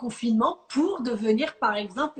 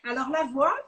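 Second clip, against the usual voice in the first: normal talking voice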